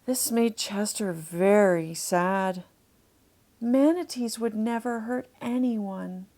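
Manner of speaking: ordinary delivery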